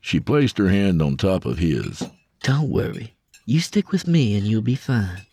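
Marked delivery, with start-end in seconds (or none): 2.4-5.2 reassuring. A narrator's reading. Lila reassuring Tep.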